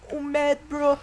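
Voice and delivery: high, loud singsong taunt; mocking taunt. Real, not acted. Gamer mocking an enraged enemy player, mocking taunt. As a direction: tease the angry enemy.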